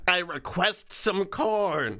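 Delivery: slow, high creature voice; a demanding fairy creature; Puka voice. Pretend. Voicing the Puka demanding corn.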